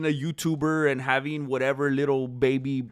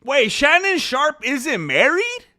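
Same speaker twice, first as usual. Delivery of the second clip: high, loud, drawn-out question; loud disbelief; 9 semitones higher, 8 dB louder, slower than usual. Real, not acted. Streamer reacting to gossip about a celebrity, shocked disbelief. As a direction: blurt it out in slow, high disbelief.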